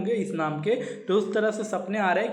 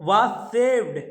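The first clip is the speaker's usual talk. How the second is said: slow poem reading; 3 semitones higher, 5 dB louder than usual. Shown as a demonstration. Teacher reads poem line slowly.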